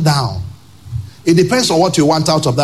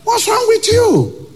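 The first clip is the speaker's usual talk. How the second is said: much higher, slow, exasperated; incredulous rebuke; slower than usual. Real, not acted. Preacher to a sulking spouse, slow high disbelief. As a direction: ask it in stretched disbelief.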